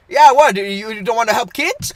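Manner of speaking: loud, indignant mock Indian accent; hammed-up offended Indian-accented man; imitated Indian accent. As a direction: challenge them in a put-on Indian accent. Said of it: "he has an accent".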